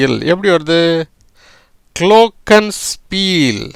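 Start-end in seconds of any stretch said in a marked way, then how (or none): 2.0-3.6 குளோக்கன்ஸ்பீல் drawn out slowly, a little higher, falling at the end; slow model word. Shown as a demonstration. Teacher carefully models குளோக்கன்ஸ்பீல் for students. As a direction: spell out குளோக்கன்ஸ்பீல் slowly in sound. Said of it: "குளோக்கன்ஸ்பீல் அப்படிங்கிற உச்சரிப்புல".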